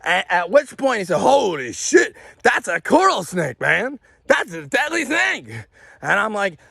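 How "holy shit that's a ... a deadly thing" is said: imitating a startled, higher exclamation; retold alarm. Man realizing it's a coral snake, mock alarm. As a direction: react as if just shown something dangerous.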